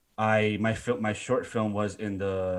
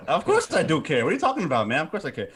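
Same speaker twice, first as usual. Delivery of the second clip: laughing; 9 semitones higher, 6 dB louder, faster than usual. Real, not acted. Laughing recovery after joke.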